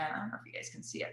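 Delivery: fast hushed whisper; quick whispered aside. Real, not acted.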